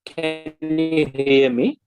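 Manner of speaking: ordinary talk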